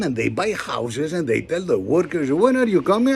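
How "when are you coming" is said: high, pushy foreign homeowner asking; mock impatient client. Homeowner pressing the Greek worker, high and insistent. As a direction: needle the worker, higher.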